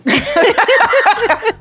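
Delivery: shrill villain laugh burst; high gloating cackle. Acted. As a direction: cackle with delight over your money. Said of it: "That's my rich person counting my money sound".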